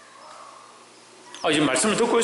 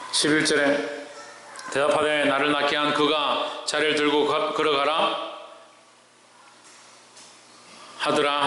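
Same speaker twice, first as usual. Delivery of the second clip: low, even-toned Bible reading; scripture reading; 3 semitones lower than usual. Acted. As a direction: read the verse in a level, measured chant. Reads scripture to congregation.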